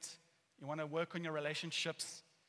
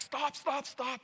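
higher, louder mock yell of stop; urgent retold shout; 9 semitones higher, 5 dB louder, much slower than usual. Man banging on driver's window as she reverses, urgent retold shout. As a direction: imitate someone yelling to halt a car. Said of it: "he's banging on the window".

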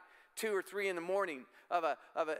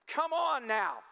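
preaching at full force; 6 semitones higher than usual. Acted. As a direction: lift the congregation into praise. Preaching climax on praise.

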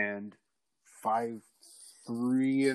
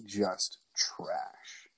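contemptuous whisper; 2 semitones lower, 5 dB quieter than usual. Retold or imitated. Telling his girlfriend she's trash, in a whisper. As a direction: whisper it with scorn.

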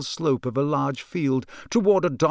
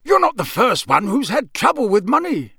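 high-pitched suspicious local; Mister Roberts; 8 semitones higher, 3 dB louder than usual. Acted. Campsite keeper scrutinizing a customer, high and suspicious. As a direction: size him up in a high character voice.

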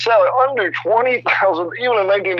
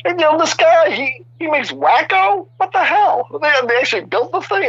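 much higher, incredulous retelling of the reaction; mock disbelief; 11 semitones higher than usual. To the interviewer, mimicking the studio's amazement, high and incredulous. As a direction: squeak out the shock as if it were absurd. Mocking disbelief about the guy.

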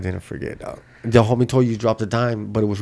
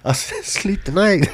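mock emphasis; 8 semitones higher than usual. Mocking friend's wording.

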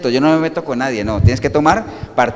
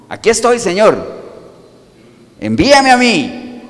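raised, drawn-out declaration to God; bold volunteering; 6 semitones higher, 4 dB louder than usual. Acted. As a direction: step up and offer yourself, high and slow. Responde al llamado de Dios. (ofreciéndose a Dios con firmeza)

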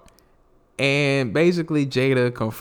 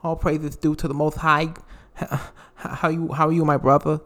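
humble Mike Tyson; 3 semitones higher, faster than usual. Retold or imitated. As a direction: greet him like a humbled Tyson. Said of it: "He's like".

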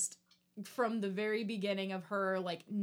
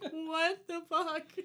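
high, slow, drawn-out astonishment; mock disbelief; 8 semitones higher, 3 dB louder, slower than usual. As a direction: stretch each word in hammed-up shock. Acted disbelief at the gore.

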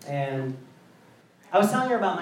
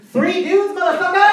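much higher, louder gleeful yell; triumphant shout; 11 semitones higher, 5 dB louder than usual. Retold or imitated. Wife topping his joke, loud gleeful shout. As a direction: spike the punchline with a yell. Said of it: "She just goes".

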